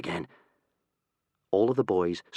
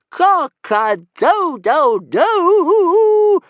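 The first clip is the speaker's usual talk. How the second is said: slow, much higher, louder rooster crow; triumphant crowing; 11 dB louder, much slower than usual. Acted. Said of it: "crowed Toothless in triumph".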